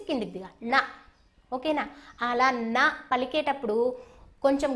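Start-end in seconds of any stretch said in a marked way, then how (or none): 0.7-0.8 నా with the tongue tip curled back to touch the palate; retroflex na. Shown as a demonstration. Teacher showing children the curled-tongue ణ sound. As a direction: say నా as a retroflex ṇa, tongue pressed up and flicked down. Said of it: "నాలుక అంచు అంగిట్లో just అలా touch చేసి".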